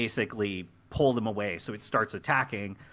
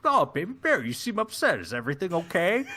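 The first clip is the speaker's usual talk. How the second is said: exaggerated concern in a put-on voice, pitched up; concerned softy officer; 4 semitones higher than usual. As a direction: coo with concern over your baby bear. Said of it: "he's got kind of a deeper voice like this".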